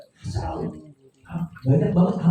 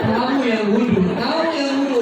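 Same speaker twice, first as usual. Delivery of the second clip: higher pitched laughing comeback; laughing retort; 7 semitones higher than usual. Retold or imitated. Wife laughing back at her husband, telling him to do wudhu. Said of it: "Kata istri saya".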